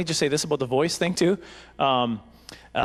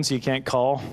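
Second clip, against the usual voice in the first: usual speaking voice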